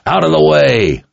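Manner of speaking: high, urgent yell; yelled command. A narrator's reading. Richard charges toward the crowd, yelling a command. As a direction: yell to clear a path. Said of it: "Richard yelled as he closed the distance".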